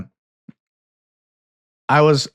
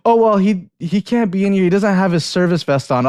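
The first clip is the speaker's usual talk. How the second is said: store staff; 7 semitones higher than usual. Retold or imitated. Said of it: "they're like".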